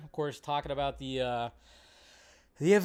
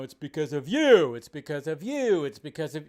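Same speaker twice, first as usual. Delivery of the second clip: louder accusing start then fading; mock blamer; 5 dB louder than usual. Vlogger parodying political blame after a shooting, accusing then fading. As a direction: jab the finger at everyone. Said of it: "everybody starts pointing the fingers".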